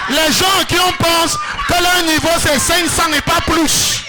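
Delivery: high, loud declamation building in pitch; full-force preaching. Real, not acted. Preacher proclaiming God's glory to the congregation, full-force shouted preaching. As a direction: proclaim it in loud, driving phrases.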